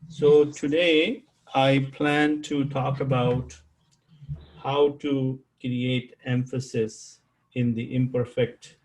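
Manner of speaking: ordinary talk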